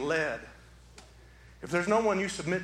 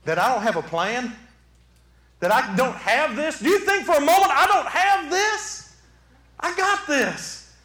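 indignant disbelief; 8 semitones higher, 6 dB louder than usual. Acted. Jesus answering those of little faith, indignant and loud. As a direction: build to high, loud incredulous questions. Acted Jesus challenging the doubters.

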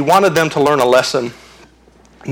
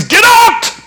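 higher, louder command; commanding shout; 6 dB louder than usual. Retold or imitated. Jesus orders the demons out, loud shout. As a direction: drive the demons out.

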